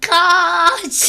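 loud, high yell to run; urgent shout. Real, not acted. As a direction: shout the warning. Urgent call when found.

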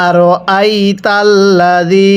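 drawn-out Arabic recitation, slow; slow Quran recitation. Shown as a demonstration. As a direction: recite slowly for students to repeat. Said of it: "আরেকবার আমার সাথে পড়বেন।". (ধীরে কুরআন তিলাওয়াত)

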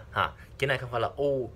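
ordinary talk

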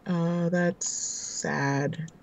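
slow flat unconcerned sympathy; deadpan. Real, not acted. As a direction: say it very slowly, not caring at all. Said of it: "I sounded so concerned".

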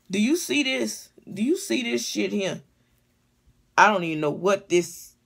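usual speaking voice

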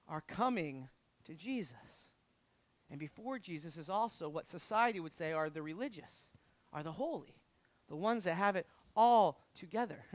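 low, soft sermon voice; hushed preaching. Real, not acted. Preacher describing who comes to Jesus, hushed. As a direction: drop right down for the congregation, soft and low.

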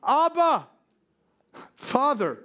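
high, emphatic cry to a father; calling out. Acted. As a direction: call out Abba, then let Father drop. Acts out the confession to God.

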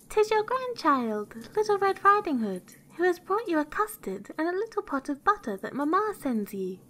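put-on very high, innocent girl voice; little girl. A narrator's reading. Girl at granny's door announces herself, high and childlike. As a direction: pipe up like a small child.

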